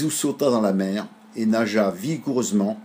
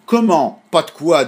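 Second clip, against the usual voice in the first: higher, louder outraged question; be shocked at the cheek; indignant; 7 semitones higher, 9 dB louder than usual. A narrator's reading. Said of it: "indignés". (indignation, plus fort et plus aigu)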